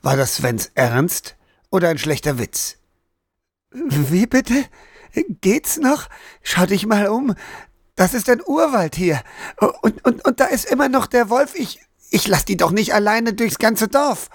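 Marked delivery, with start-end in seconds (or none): 3.7-14.3 high, agitated protest with a nervous laugh; outraged disbelief. Acted. Daniel protests to Sven, high and agitated. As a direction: argue it, unable to believe him.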